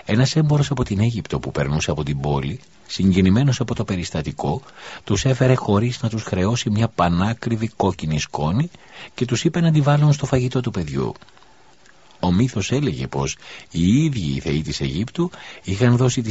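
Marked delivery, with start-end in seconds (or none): none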